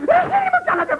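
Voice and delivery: very high-pitched, anguished reproach; outraged cry. Acted. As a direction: let the accusation shoot up into a wail. (τσιριχτή αγανάκτηση)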